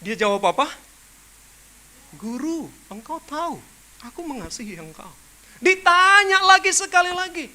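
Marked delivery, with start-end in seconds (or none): 2.2-5.1 half-hearted reply. Retold or imitated. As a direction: mumble it as if you don't mean it. Peter's second reply.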